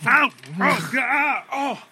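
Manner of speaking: high, sharp cries of pain; pained yelp. Acted. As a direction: yelp like you got stabbed. Game character hurt.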